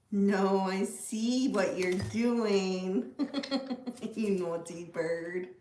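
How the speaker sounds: slow, drawn-out mock telling-off; playful pet scolding. Real, not acted. Owner catching her bird after her juice, playfully scolding. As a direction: stretch it out, teasing, with a chuckle between.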